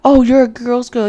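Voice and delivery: high, sing-song mockery of a girl's girl; mocking. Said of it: "she mocks her".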